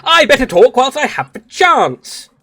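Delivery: high, loud, hammed-up British voice; ultra British. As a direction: seize the moment in exaggerated British. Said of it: "I might need to go ultra British".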